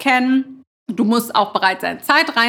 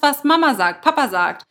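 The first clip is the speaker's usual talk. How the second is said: usual speaking voice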